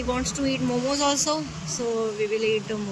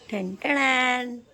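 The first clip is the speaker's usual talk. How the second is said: slow, sing-song ta-da reveal; drawn-out ta-da; 2 semitones higher, much slower than usual. Real, not acted. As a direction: stretch out the ta-da like a fanfare. Surprise reveal for husband.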